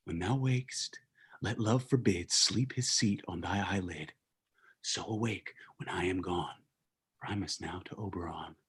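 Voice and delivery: hushed incantation fading out; fading whisper. Acted. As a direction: cast the spell in a whisper and steal away. Puck casting spell, slipping away.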